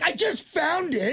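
high, slow fake innocence; innocent excuse. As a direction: play dumb, drawn out. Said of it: "you can't be like".

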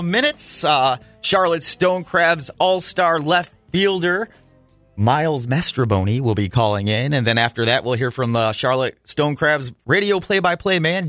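usual speaking voice

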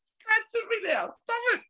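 high-pitched giggling through the words; laughing so hard the voice cracks up high; helpless laughter. Real, not acted. Scolding the cat while laughing helplessly. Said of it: "this cat's driving me nuts".

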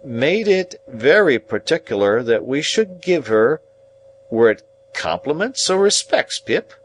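laboured recollection ending in a question; effortful remembering. A narrator's reading. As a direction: grope for the exact words, then ask. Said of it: "like an effort of remembrance".

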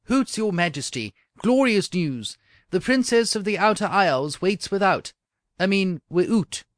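loud, high Scots announcement; Scottish accent. Acted.

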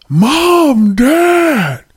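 high, drawn-out holler; calling out for parents. Acted. Child finds the freezer food rotten, hollering for parents. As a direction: holler the words long and high for your parents.

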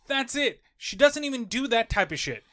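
ordinary talk

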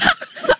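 short high giggle; laugh. Real, not acted. Laughing at her own chip confession, high giggle. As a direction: giggle at yourself.

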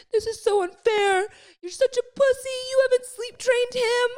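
very high, tearful mock whine; imitated crying. Mum retelling her upset call to Fran, mock crying. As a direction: wail it like you're crying, hammed up. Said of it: "you know, when you're crying".

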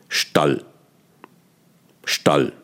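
"Stall" with a short clipped a; clipped vowel. Shown as a demonstration. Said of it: "dieses A verkürzt".

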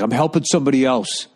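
annoyed employee snapping he's busy; mock upset employee. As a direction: play the flustered clerk brushing him off. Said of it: "Like, all fucking upset".

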